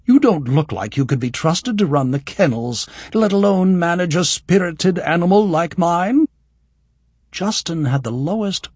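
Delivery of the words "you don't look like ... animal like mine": haughty noblewoman. A narrator's reading. Haughty noblewoman insults stablehand.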